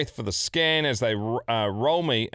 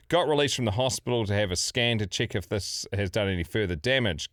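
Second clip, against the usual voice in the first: ordinary talk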